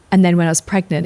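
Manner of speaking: ordinary talk, nothing marked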